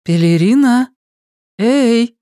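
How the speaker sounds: loud high call into the house. Acted. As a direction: call her sister loudly. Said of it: "позвала Сага".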